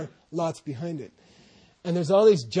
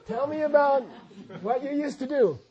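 condescending; 8 semitones higher than usual. Retold or imitated. Speaker imitating visitors talking down to old folks, condescending.

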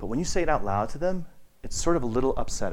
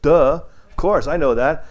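slower, louder know-it-all scoff; mock duh; 3 semitones higher, 8 dB louder, slower than usual. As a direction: brush it off as obvious. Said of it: "my brother's like".

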